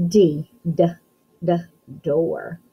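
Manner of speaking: repeated d sound, then the word door. Shown as a demonstration. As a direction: tap the d three times, then say door.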